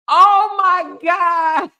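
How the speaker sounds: high, loud and slow, overwhelmed; moved exclamation. Real, not acted. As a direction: stretch it out, touched. Moved by donations.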